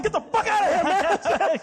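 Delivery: very high, hammed-up shout; mock angry yell. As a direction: yell them away from the window, comically. Acted staff yelling out the window.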